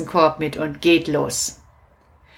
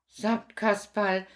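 ordinary talk

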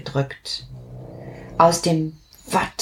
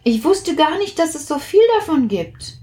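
amazed; 9 semitones higher, faster than usual. Acted. Plitsch marvels.

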